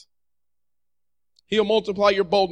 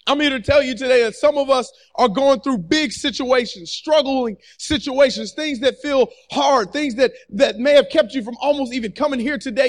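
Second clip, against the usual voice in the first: ordinary delivery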